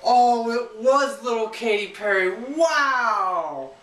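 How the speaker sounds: high, loud hammed-up awe; sarcastic wow at an obvious twist; mock amazement. Mock reaction to the reveal.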